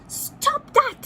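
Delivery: high, loud duck squawk; bossy duck scolding the dragonflies; cross duck quack. Acted. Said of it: "he quacked".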